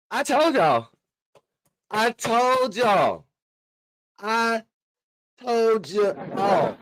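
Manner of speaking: slow, high, stretched-out I told you so; milk every word like a victory lap; drawn-out gloating. Acted. Host gloating to listeners about the rival quarterback's injury, stretched out.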